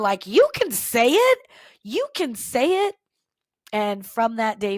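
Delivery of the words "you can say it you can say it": put-on high, loud chorus of cheering-on kids; encouraging students. Students urge their teacher to say it, encouraging. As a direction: imitate students urging, voice leaping up on the words.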